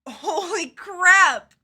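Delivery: loud, slow, high holy crap; shocked exclaim. Real, not acted. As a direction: blurt it out in shock. Back cracking during stretch.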